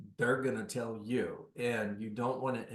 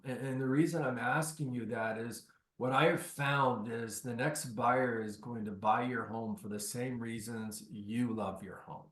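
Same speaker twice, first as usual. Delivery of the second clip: usual speaking voice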